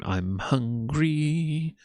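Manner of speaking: slow, drawn-out mocking "I'm hungry"; mock whine. A commentator mocking the streamer's whiny hunger. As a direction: stretch it out like a whiny kid. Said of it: "accompanied by dum diddly dum".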